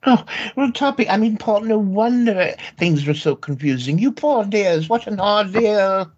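put-on high, fluttery elderly aunt voice; fussy old aunt. Aunt fussing over her nephews in a high old-lady voice.

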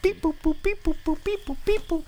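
high beeping robot noises; toy robot voice. Pretend. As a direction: beep boop like a little toy robot. Playing with robot figure.

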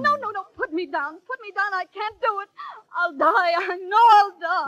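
high wail of stage fright; panicked protest. Acted. Pupil being carried on to play Juliet, panicked.

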